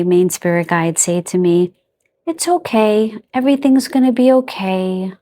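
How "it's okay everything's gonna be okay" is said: soothing, higher-pitched reassurance; loving parent. Retold or imitated. Spirit guide reassuring the speaker like a loving parent, soothing. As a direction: comfort tenderly, like a parent stroking a child's hair. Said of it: "it's like a very loving parent".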